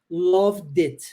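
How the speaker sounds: "loved it" slow as one word, lovdit; linked d. Shown as a demonstration. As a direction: join the D to the I. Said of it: "Eu junto o D com o I". ("loved it" juntando o D com o I, devagar)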